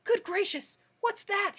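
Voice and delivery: high, loud startled yell. Acted. The Gnome King, startled by a hen's cry, yells high and loud. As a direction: jump out of your skin and yell it. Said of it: "he yelled".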